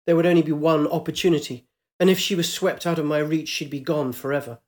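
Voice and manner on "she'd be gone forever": usual speaking voice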